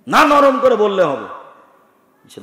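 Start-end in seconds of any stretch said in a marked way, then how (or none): 0.1-1.2 loud, high retort; shouting insistence. Retold or imitated. Airport stranger insisting loudly to the preacher. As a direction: push back at him with a raised voice. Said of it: "যখন খুব চিৎকার দিয়ে বলছে".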